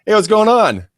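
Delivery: awkward fake-smile hello; awkward greeting. Shown as a demonstration. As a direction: overdo a stiff, staring greeting. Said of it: "this is what you don't wanna do".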